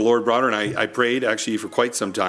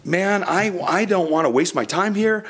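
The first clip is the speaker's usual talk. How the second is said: raised, earnest plea to God; retold desperate prayer, voice lifted; pleading prayer; 7 semitones higher than usual. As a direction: beg the Lord for a sign, pitch up high. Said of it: "And I was saying".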